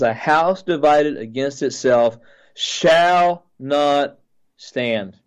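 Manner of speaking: slow proclamation with "shall" punched and stretched, "stand" dropping; stress on SHALL. Retold or imitated. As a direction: imitate a solemn declaration, leaning hard on "shall". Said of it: "he emphasized the word shall loudly".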